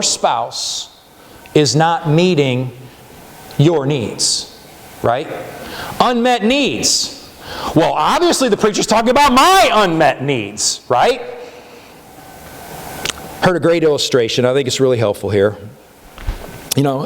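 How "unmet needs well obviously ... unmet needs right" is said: mock smug listener, lightly voiced; self-centered listener. Preacher voicing a smug listener who hears only their own needs. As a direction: play the spouse who thinks it's all about them.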